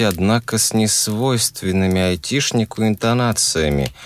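ordinary delivery, nothing marked

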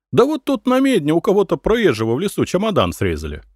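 high, quick village gossip; gossiping peasant woman. A narrator's reading. Peasant woman telling merchants of a forest robbery, high, quick gossip. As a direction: rattle off the news in a high peasant voice.

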